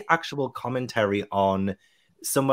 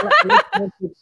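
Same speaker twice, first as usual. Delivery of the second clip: high-pitched laugh breaking through the words; 9 dB louder than usual. Real, not acted. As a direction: laugh it out, much higher and louder. Laughing about liking haunted dolls.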